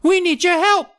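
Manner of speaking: tiny gnome proud of mischief; mischievous gnome voice. Acted. Gnomes bragging to the kids about mischief, very high. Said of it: "Hap added".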